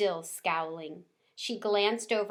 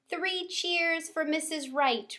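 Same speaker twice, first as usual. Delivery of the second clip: mild yell; 9 semitones higher than usual. A narrator's reading. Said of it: "Rosie yelled".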